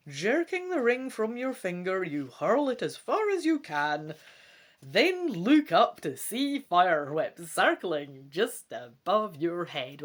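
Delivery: dramatic gamebook narration; story narration. A narrator's reading. Reading the gamebook to viewers, dramatic narration. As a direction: read it out like an adventure story.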